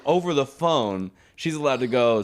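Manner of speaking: ordinary talk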